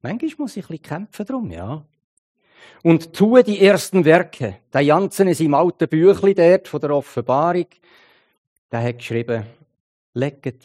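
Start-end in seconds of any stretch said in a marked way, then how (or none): 2.8-4.6 tue lifted and drawn out, loud and slow; the whole phrase slowed, weight on tue; stress on tue. Shown as a demonstration. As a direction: lean hard on tue, unhurried. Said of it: "die Betonung auf tue legt".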